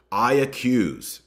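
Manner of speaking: grave, emphatic charge; solemn accusation. Acted. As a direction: pronounce it like a verdict, slowly. Reading the formal accusation.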